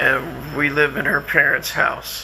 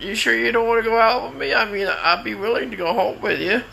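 mock flirty pleading; 8 semitones higher, faster than usual. Flirting with the psychiatrist.

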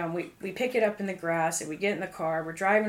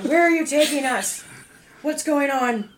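high, loud mock-suspicious questions; retelling own suspicion in the van; suspicious demand; 7 semitones higher, 7 dB louder than usual. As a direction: push back, wary of being tricked into the van. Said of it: "all, like, skeptical".

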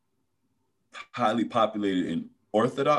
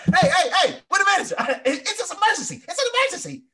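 much higher, laughing fake panic; mock frantic customer; 3 dB louder, faster than usual. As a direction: beg for a table in hammed-up alarm. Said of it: "run in that joint and be like".

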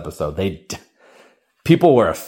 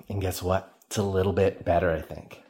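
much softer, tentative; sheepish hush; 5 semitones lower, 10 dB quieter than usual. Real, not acted. Host quietly admits the new song is only slightly better. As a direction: say it low, half-embarrassed. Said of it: "trailing sheepish claim".

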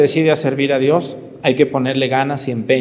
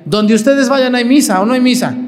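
mock scolding, higher and louder; teasing retort; 8 semitones higher, 4 dB louder than usual. Priest teasing the parishioner, mock scolding. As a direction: needle them playfully.

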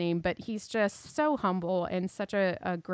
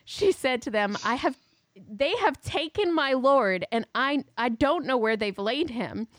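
louder, higher, theatrical scripture voice; dramatic Bible reading; 5 semitones higher, 4 dB louder than usual. Acted. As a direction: perform the Gospel dialogue with flair. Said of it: "I know".

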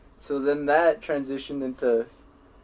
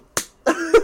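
a sudden high laugh mid-story; high bursting laugh; burst of laughter; 7 dB louder than usual. Real, not acted. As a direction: laugh out loud at the memory. Laughing while telling stabbing story.

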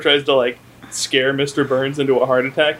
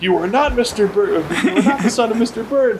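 mock shouting; 7 semitones higher than usual. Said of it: "starts shouting".

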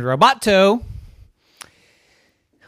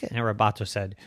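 plain reading voice

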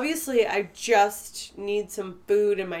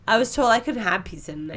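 usual speaking voice

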